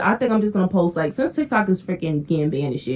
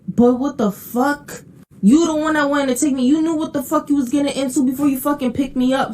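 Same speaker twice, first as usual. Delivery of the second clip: exasperated, pitched-up retelling rant; higher, heated what-the-fuck rant; indignant rant; 6 semitones higher than usual. Retold or imitated. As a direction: vent like you're still outraged. Ranting about gas money request.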